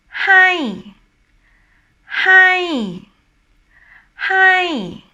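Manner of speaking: "hi" high and loud, then dropping; falling tone. Shown as a demonstration. Teacher giving example words, falling tone "hi". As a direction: hold "hi" up, then let it fall. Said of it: "talking about a falling tone".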